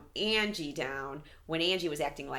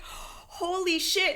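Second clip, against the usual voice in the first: mock shock; 12 semitones higher, much slower than usual. Shocked reaction, laughing.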